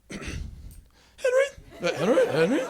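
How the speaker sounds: nervous squeak. Acted. Nervous boy telling a girl his name, squeaking. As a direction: voice leaping very high, unsure of itself.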